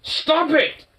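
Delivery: high, sharp stop order; angry command. Real, not acted. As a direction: bark it at the dog. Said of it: "I'm actually getting pissed about that".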